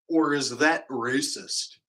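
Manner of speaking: ordinary delivery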